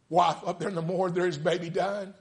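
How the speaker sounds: high, loud preaching cry; preaching at full force. Acted. Preacher retelling the story, full-force preaching.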